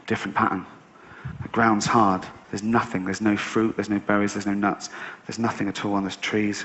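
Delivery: usual speaking voice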